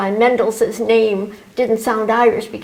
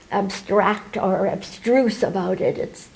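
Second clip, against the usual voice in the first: ordinary delivery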